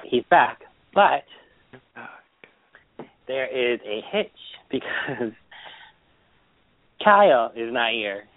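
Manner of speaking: normal talking voice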